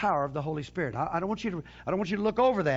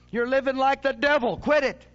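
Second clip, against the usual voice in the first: loud, higher-pitched call to repent; stern preached rebuke; 7 semitones higher, 6 dB louder than usual. Acted. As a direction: thunder it like a prophet scolding sinners. Acting out John's preached rebuke.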